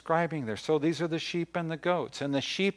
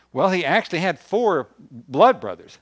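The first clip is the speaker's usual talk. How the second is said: emphatic preaching; 5 semitones higher, 10 dB louder than usual. Real, not acted.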